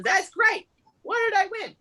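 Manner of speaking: high-pitched acted delight; delighted winner. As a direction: play a thrilled raffle winner. Acted raffle winner reacting.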